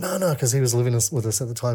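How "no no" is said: panicked protest. Retold or imitated. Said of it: "I started panicking".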